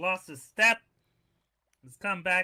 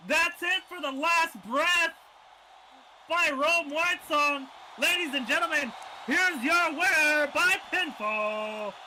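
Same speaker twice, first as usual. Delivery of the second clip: big, slow, stretched ring announcer call; 5 semitones higher, 5 dB louder, slower than usual. Acted. Announcer presenting the winner to the crowd, booming. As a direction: draw out the winner's name.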